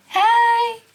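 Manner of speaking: high squeaky little hello; cheery mouse greeting. Pretend. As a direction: wave hi as a tiny mouse. Makes the mouse wave hello.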